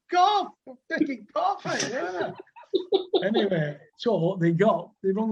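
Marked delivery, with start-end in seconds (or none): 0.1-2.3 laughing through the words, much higher; chuckling as he tells the punchline. Real, not acted. Old cricketer laughing at his own joke.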